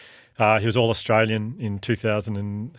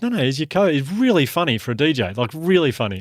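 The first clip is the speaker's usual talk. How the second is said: eager fan; 6 semitones higher, 3 dB louder than usual. Retold or imitated. Fan voiced in anecdote.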